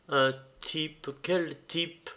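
usual speaking voice

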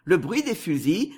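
plain reading voice

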